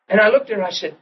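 ordinary delivery

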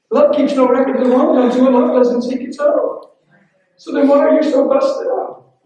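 high, loud, rising preaching; fiery sermon climax; preaching at full force. Acted. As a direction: drive it home to the congregation. Preaching builds up.

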